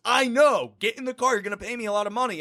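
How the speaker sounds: high-pitched, exasperated retold bargaining; acted insistent urging. Taxi driver haggling with a wary passenger, high insistent urging. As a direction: imitate a driver pressing the deal up high.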